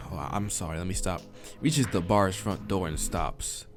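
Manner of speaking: normal talking voice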